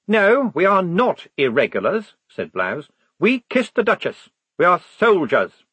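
high, loud, stiff officer voice; proud lieutenant declaring loyalty; earnest young officer. A narrator's reading. Blouse insists.

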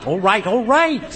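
defensive protest. Acted. Tom told to shut up, protesting. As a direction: protest loudly at being told off.

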